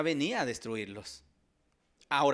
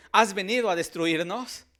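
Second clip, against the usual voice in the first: louder, higher mock demonic question; lightly voiced demon cry; demons' outcry; 6 semitones higher, 8 dB louder than usual. Preacher quoting demons seeing Jesus, raised voice.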